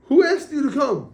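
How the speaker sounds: high, loud indignant outburst; mock scream of protest; retold scream. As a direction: scream it at the whole congregation, acted out. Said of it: "he screams out".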